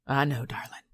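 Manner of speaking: a light Southern Louisiana drawl on "I know, darling". Retold or imitated. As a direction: ease into a gentle Louisiana drawl, lightly voiced. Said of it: "in his deep Louisiana drawl".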